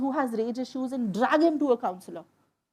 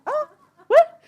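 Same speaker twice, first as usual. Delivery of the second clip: surprised; 13 semitones higher, 9 dB louder than usual. Retold or imitated. Incredulous reaction to odd question.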